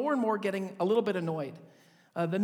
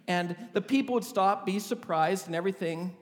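usual speaking voice

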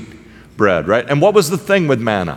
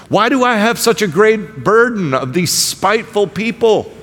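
raised, mocking exasperation; sarcastic Moses; 6 semitones higher than usual. Moses sarcastically complains to God about the people, raised mocking tone. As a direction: lay the sarcasm on as Moses talking back to God. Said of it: "Can you hear Moses' sarcasm to God?".

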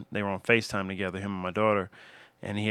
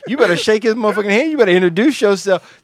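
higher, louder, laughing mock order; mock fierce daughter; 10 semitones higher, 9 dB louder than usual. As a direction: play the daughter bossing the boyfriend. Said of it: "That was the look and the head shake she gave him".

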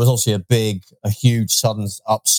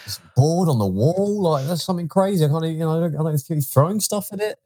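higher-pitched mock incredulity; mock bafflement; 6 semitones higher than usual. Co-host pretends darts is an alien game, mock baffled. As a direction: act baffled by a strange invention.

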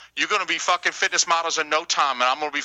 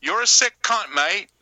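higher-pitched mock Australian accent; 5 semitones higher than usual. Shown as a demonstration. Podcaster showing cohosts the harmless version, in Australian accent. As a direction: put on a cheeky Aussie accent. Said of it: "say it with an Australian accent".

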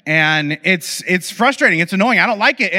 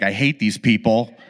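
bitter grumble; 8 semitones lower than usual. Retold or imitated. Happy riders pass by.

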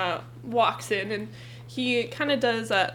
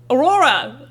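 shout across bar; 3 semitones higher, 9 dB louder, slower than usual. Acted. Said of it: "Oh, that was bad".

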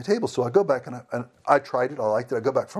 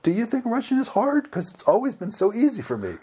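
higher, put-on innocent questioning; imitated woman server; 10 semitones higher than usual. Server wondering if Russian is hard, high mimicry. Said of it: "She goes".

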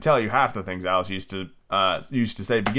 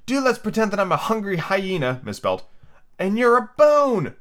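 sudden shout; 8 semitones higher than usual. A narrator's reading. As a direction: shout it right into her ear. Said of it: "shouting suddenly in her ear".

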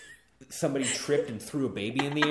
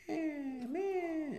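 high, soft little bleat; guessed deer call; 6 dB quieter than usual. Retold or imitated. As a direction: bleat softly like a cute deer. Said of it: "And she was like".

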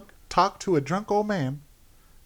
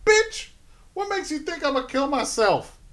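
mock indignant; 10 semitones higher than usual. Imitating a man refusing to kill himself, high mock indignation. Acting out character refusing to die.

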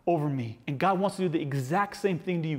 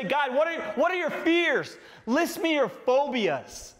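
mock question; 9 semitones higher than usual. Mock version of Jesus' question.

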